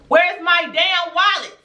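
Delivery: high, drawn-out indignant demand; retold furious demand. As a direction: stretch it out high as the temper boils over. Retelling her wallet confrontation, escalating.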